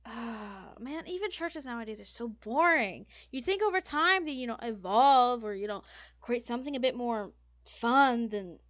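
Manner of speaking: high, emphatic diva complaint; disdainful fashion designer. Acted. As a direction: lament it with theatrical disappointment.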